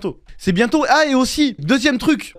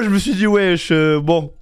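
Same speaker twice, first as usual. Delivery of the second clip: ordinary delivery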